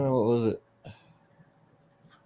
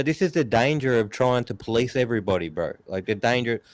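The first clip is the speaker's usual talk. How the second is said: usual speaking voice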